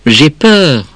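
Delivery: put-on a touch of childish fright; scared child. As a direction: confess fear like a small kid. Child tells mother of fear.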